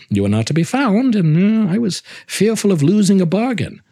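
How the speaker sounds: eager excuse. Acted. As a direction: sell the worry about missing the bargain. Montresor explaining eagerly.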